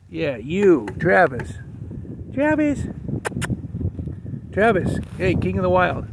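loud, high, delighted calling of the dog's name. Real, not acted. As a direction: call him out, relieved and teasing. Calling dog found in closet.

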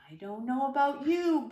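ordinary delivery, nothing marked

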